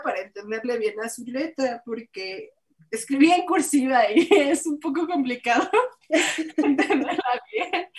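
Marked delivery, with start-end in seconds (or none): 2.9-7.9 laughing. Real, not acted. Laughs about the handwriting.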